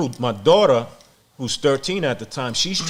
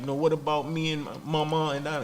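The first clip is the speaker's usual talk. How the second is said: higher, softer voice of an upset daughter; imitated tearful pleading; 4 semitones higher, 5 dB quieter than usual. Daughter asking her father about herself and mama, imitated crying. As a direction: mimic a child's plaintive protest. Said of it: "crying profusely".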